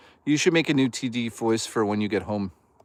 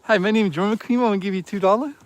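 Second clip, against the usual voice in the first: mock donor voice; 7 semitones higher, faster than usual. Streamer repeating the chat joke as a dopey donor. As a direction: say it again, same dopey donor. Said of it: "That's my new favorite joke".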